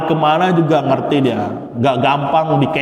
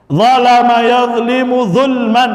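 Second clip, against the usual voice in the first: recitation drill; 7 semitones higher, slower than usual. Shown as a demonstration. Teacher reciting the masdar gallery aloud, chanting. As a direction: recite each pattern clearly for the class.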